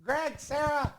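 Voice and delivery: high-pitched aunt calling parents over to tattle; high, imitated aunt voice; scolding aunt. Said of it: "I can hear my aunt".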